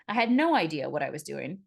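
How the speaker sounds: usual speaking voice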